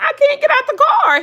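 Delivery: a stuck driver wailing she can't get out; panicked whine. Retold or imitated. Wife to husband on phone, high panicked wail.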